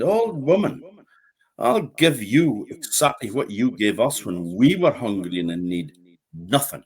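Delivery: a lightly voiced boy, cold and final; hint of a boy's scornful refusal; stern young boy. A narrator's reading. Boy refuses the old woman.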